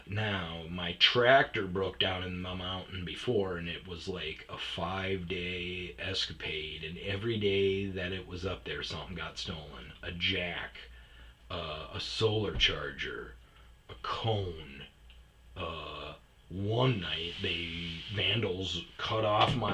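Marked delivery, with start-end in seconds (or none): none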